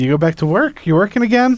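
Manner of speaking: a hammed-up sheriff's surprised question; mock sheriff. Sheriff voiced in retold scene.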